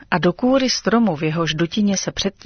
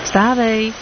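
cheerful wake-up call; 4 semitones higher than usual. Acted. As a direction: call her awake cheerfully. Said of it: "veselý hlas Kazima".

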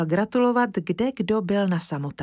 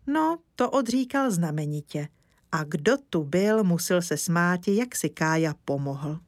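ordinary delivery

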